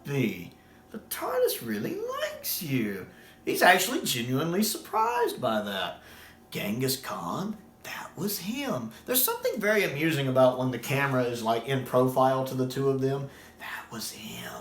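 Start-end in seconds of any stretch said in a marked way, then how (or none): 0.9-3.1 very high, slow put-on voice; mock the Master in a high, slow, silly voice; imitating the Master. Fan imitating the Master's slow, high-voiced line. 13.6-14.5 hushed, breathy aside; whispered aside. Retold or imitated. Fan whispering the Master's line as a sly secret.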